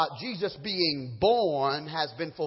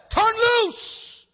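very high, loud slow order; the Lord tells the man to let go; divine command; 7 dB louder than usual. Acted. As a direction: command it from above. The Lord's command.

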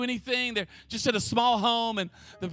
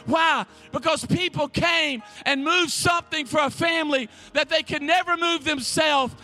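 full-force preaching; 3 semitones higher than usual. Real, not acted. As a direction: drive it home to the church.

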